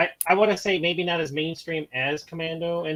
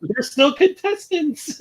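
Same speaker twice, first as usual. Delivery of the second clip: mock surprise; 9 semitones higher, 6 dB louder, much slower than usual. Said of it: "It's like".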